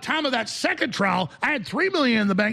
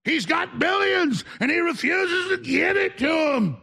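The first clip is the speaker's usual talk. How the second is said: much higher, slow, shouted parody; mocking media cry; mock headline outrage; 8 semitones higher than usual. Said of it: "You know".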